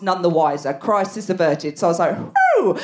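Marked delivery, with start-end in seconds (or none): 2.2-2.8 relieved whew. Retold or imitated. Relief sound.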